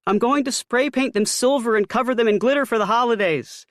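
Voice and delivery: high, loud mother's voice; Ma's voice. A narrator's reading. Mother describing her glitter plan to her son. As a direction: gush about the craft plan as Mom.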